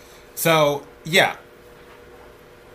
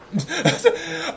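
short laugh; 14 semitones higher than usual. Real, not acted. Laughs at the robot's name.

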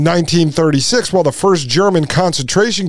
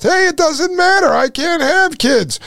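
high-pitched mock old lady; protesting grandma; 12 semitones higher than usual. Grandma objecting to airport screener picking her, high mock protest. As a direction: squeak out a hammed-up grandma protest.

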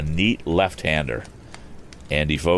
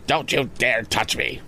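higher, whining threat; whiny bully; 7 semitones higher than usual. A narrator's reading. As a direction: whine it, scared but defiant. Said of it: "there was a whine in his tones".

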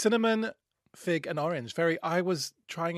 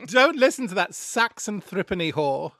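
hammed-up insult; 4 semitones higher, 9 dB louder than usual. Mock insult in character.

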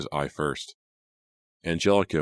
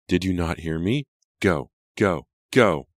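rising shout, louder on each go; screaming; 2 semitones higher, 5 dB louder than usual. A narrator's reading. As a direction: scream him out of the room. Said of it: "she started to scream".

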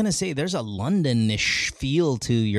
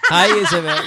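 laughing greeting; 7 dB louder than usual. Real, not acted. Host laughs at his own slip.

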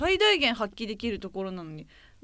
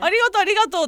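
loud, high repeated thanks; acted grateful exclamation; effusive thanks; 8 semitones higher, 9 dB louder, faster than usual. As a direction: gush thanks as a delighted customer. Acted grateful reply to the offer.

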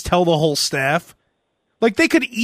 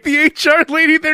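ordinary delivery

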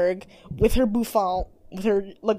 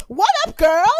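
high, loud bubbly hello; excited greeting; 14 semitones higher, 5 dB louder than usual. Retold or imitated. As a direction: burst in cheerful and high. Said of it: "she was like".

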